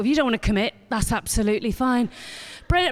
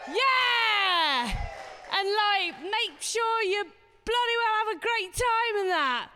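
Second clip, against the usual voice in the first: hyped-up cheerleader; 7 semitones higher, 3 dB quieter, slower than usual. Pretend. Compere firing up the crowd, mock-enthusiastic yell. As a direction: whip the audience into enthusiasm. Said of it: "trying to make you enthusiastic".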